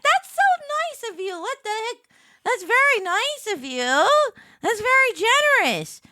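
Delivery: high, loud gushing thanks; gushing quote. Retold or imitated. Streamer imitating a gushing thank-you to a donor. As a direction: squeal the thanks like an overwhelmed fan. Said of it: "Be like".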